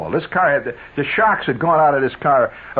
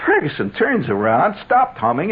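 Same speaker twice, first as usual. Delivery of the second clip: ordinary delivery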